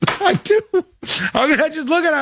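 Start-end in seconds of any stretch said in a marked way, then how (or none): 0.0-0.6 laughing high and bright; giggling confession. Real, not acted. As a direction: laugh the admission out. Laughing admission after victim shaming jab.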